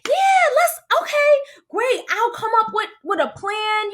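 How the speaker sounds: very high, overeager fake cheer; pretended enthusiasm. Hiding hurt while agreeing to plan, pretended enthusiasm. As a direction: start squeaky-high and ease down while playing along. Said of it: "but I'm pretending still".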